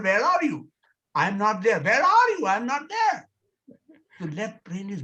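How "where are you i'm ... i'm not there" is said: teasing, louder calls to fear; sing-song hide and seek with Mister Fear; playful calling. Pretend.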